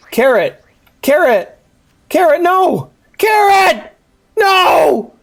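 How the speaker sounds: rising panicked shrieks, calling after carrot; panicked yells. Real, not acted. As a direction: scream for the lost carrot, building to high-pitched wails. Losing the carrot companion.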